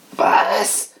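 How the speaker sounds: high, outraged squawk; screech. A narrator's reading. Vain rat hears her tail called ugly, screeching. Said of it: "kreischte die Ratte".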